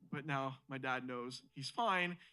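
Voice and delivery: usual speaking voice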